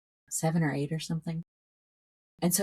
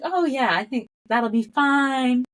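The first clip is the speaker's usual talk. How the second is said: imitating a breezy phone reassurance, higher and slow; mock-unbothered, pitched up; casual reassurance; 7 semitones higher, 4 dB louder, slower than usual. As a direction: drag out "fine" as if all is well. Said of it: "I'm talking like this on the phone".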